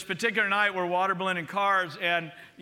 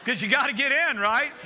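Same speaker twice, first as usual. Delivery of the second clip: fervent preaching; 5 semitones higher than usual. Acted. Pastor punching the punchline to the church, fervent preaching. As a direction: drive the point home.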